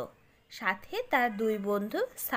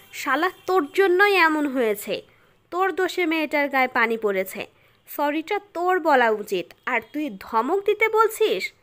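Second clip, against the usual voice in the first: loud, higher-pitched furious telling-off; angry scolding; 4 semitones higher, 6 dB louder than usual. A narrator's reading. Nishad berating the friend who caused the mess, angry. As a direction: lay into a friend with angry accusations.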